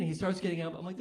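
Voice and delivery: normal talking voice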